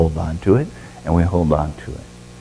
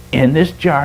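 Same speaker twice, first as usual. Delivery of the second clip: ordinary delivery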